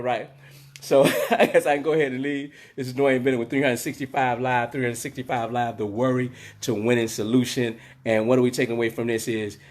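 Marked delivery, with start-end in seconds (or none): none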